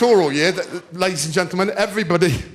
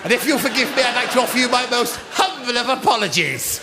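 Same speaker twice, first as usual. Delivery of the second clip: put-on fast, prim, formal old-fashioned voice; prim schoolgirl impression. Pupil apologising to her drama teacher, prim and proper. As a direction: deliver it as a buttoned-up 1940s housewife. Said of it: "this impression".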